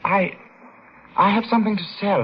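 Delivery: put-on frightened boy offering coins to a brute; nervous boy. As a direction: stammer it out scared and eager. Frightened boy pleading with shopkeeper.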